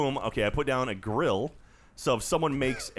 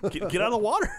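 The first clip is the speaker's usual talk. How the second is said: urgent shout; 10 semitones higher than usual. Real, not acted. Friend warning Bruce the shark is coming, high urgent shout. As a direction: shout him out of the water fast.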